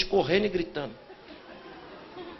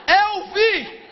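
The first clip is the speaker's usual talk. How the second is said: excited shout; 10 semitones higher, 3 dB louder than usual. Pretend. Exclaiming he saw [name].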